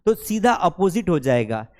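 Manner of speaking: ordinary talk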